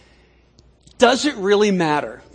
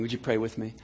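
ordinary talk

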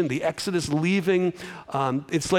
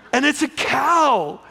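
ordinary delivery

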